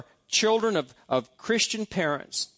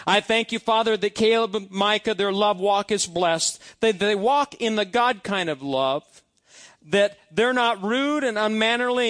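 raised, fervent prayer read aloud, a bit higher; prayer of blessing; 4 semitones higher, 4 dB louder than usual. Acted. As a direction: pray the blessing over the sons with conviction.

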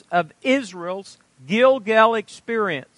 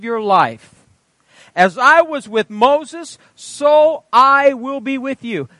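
raised, ringing Bible reading; scripture reading; 5 semitones higher, 4 dB louder than usual. Acted. Preacher reading Joshua to the congregation, ringing proclamation. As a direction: proclaim the verses with weight.

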